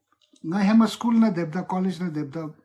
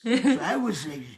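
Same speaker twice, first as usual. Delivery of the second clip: French accent; 2 semitones higher than usual. Shown as a demonstration. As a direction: complain in a hammed-up French accent. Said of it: "I can do a French accent".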